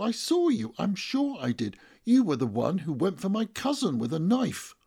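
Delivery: high, loud insistence; accusing. A narrator's reading. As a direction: pin the crime on him publicly. Witness accuses him.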